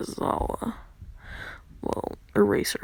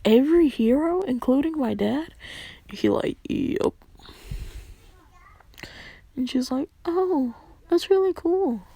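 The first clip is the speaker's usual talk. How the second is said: excited; 6 semitones higher than usual. Acted. Girl amazed by his deed.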